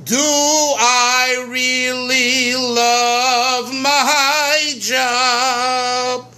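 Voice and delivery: drawn-out, longing question; yearning question. Shown as a demonstration. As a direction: stretch it out with longing. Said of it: "That's a yearning of a question".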